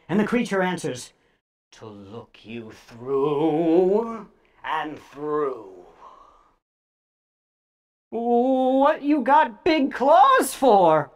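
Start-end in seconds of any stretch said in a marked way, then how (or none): 1.7-5.8 very slow, sinister creature voice; creature answering. A narrator's reading. 8.1-11.1 put-on frightened boy. A narrator's reading. Frightened boy questions creature.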